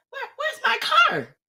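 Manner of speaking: very high, squeaky tearful question; retold wail of bewilderment; panicked. Stranded woman cannot find her car, panicked wail.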